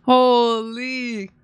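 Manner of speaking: ordinary talk, nothing marked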